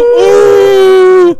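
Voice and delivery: very high, loud, slow squeal; shrieking ooh. Retold or imitated. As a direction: belt it like a rock singer. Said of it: "Anaconda gives you".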